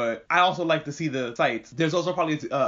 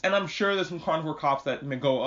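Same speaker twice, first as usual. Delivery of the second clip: normal talking voice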